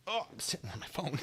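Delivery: whispered embarrassed aside; whispering. Real, not acted. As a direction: drop to a whisper.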